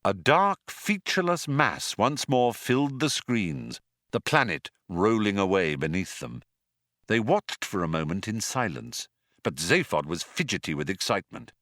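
ordinary delivery, nothing marked

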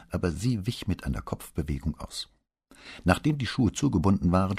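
ordinary delivery

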